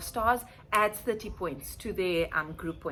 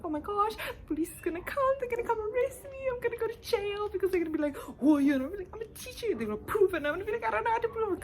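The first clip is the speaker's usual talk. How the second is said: high, whiny mock panic with a cry in the voice; fake-sob the fear of being arrested; panicked; 13 semitones higher, faster than usual. Teacher imagining police arresting her: high mock panic. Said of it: "I'm freaking out".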